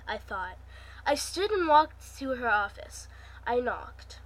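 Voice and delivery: reading a novel chapter aloud to viewers; story narration. A narrator's reading. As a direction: tell the story steadily, as a reader. Reads her story chapter aloud.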